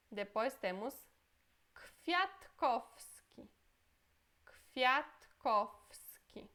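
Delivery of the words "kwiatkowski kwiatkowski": Kwiatkowski slowly, w as f; Polish pronunciation. Shown as a demonstration. Teacher explaining W said as F, slow. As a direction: lean on the sounds of Kwiatkowski. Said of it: "Esse W ... som de F".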